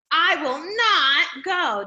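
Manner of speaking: loud, slow, every word pressed hard; bold emphasis. Shown as a demonstration. Teacher showing bold print, loud slow emphatic refusal. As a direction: lean on each word of "I will not go". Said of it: "that's bolded right there".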